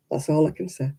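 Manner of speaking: usual speaking voice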